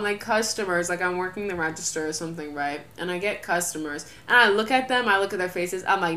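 normal talking voice

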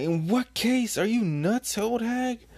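very high, incredulous squawk; hammed-up indignant outburst; outraged retort. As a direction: throw it back in high-pitched disbelief. Queen outraged at Big Mom.

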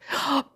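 sharp high intake of breath; excited gasp. A narrator's reading. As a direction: gasp with eager anticipation. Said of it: "She let out another gasp".

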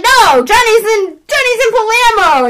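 high, loud protest; hammed-up disbelief; retold flustered denial. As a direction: insist in mock panic. Daughter protests the news.

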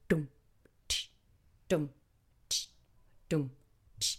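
softly voiced dum and tsh drumbeat; imitated beat. Shown as a demonstration. Showing listeners the music's beat, quiet mouth drum. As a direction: tap out the rhythm with your mouth. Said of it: "It goes like".

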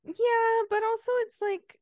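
high, loud squeaky yeah; excited squeal. Real, not acted. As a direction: burst out in a squeal. Sudden high squeal of agreement.

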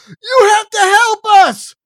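high, loud panicked plea; crying out. Acted. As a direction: shout for help in terror. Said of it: "the Murphys cry".

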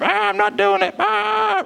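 high-pitched mock child's wail; upset crying child. Dad imitating his upset daughter at swimming lessons, mock crying. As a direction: wail it like a child refusing, hammed-up. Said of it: "she's crying, she's upset".

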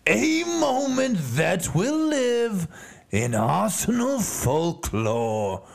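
ordinary talk

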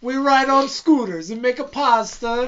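high-pitched cartoon Italian accent; attempted Italian accent. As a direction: play up a bad Italian accent. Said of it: "I can't do an Italian accent".

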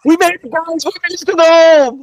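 mock triumphant cheer.

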